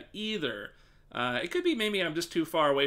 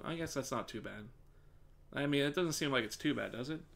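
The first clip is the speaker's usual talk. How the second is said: ordinary talk, nothing marked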